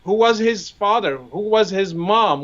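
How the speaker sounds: ordinary delivery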